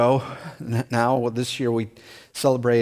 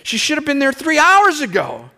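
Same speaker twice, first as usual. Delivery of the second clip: fast, much higher voice rising to alarm; mock panic; 11 semitones higher, faster than usual. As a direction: rush it up high in rising panic. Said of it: "It's like".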